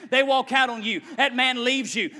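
ordinary talk

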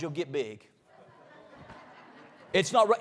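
ordinary talk

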